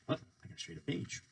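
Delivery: quick whispered mutter; whispered aside. Real, not acted. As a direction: mutter it under your breath. Muttered aside while reading.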